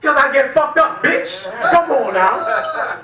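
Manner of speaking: high, loud yelled punchline; shouted boast, drawn out at the end. Real, not acted. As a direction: yell it at the crowd.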